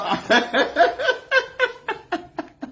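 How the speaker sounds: long, high, rolling laugh. Real, not acted. Laughing long about the leader.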